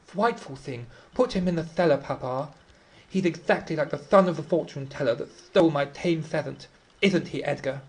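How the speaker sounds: put-on a spoiled little girl pleading with papa; lisping girl. A narrator's reading. Girl begging papa to lock the intruder away, high childish lisp. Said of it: "Isabella lisping".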